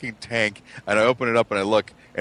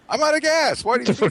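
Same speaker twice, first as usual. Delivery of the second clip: exasperated. Retold or imitated. Exasperated reply to dad.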